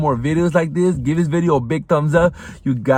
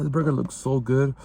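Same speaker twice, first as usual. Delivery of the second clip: usual speaking voice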